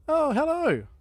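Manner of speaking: high, loud startled greeting; startled surprise. Real, not acted. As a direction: jump at the sudden company. Zombies suddenly appear.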